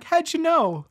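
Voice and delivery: high startled question; surprised. Acted. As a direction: ask it in shock. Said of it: "he's like".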